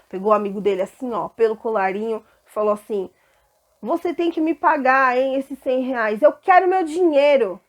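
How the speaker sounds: grab him by the collar and shout; angry creditor. Acted. Grabs his friend by the collar. (servo cobrando o amigo com raiva, em voz alta)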